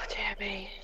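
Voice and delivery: breathless relieved whisper. Real, not acted. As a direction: whisper it out, shaken. After the scare.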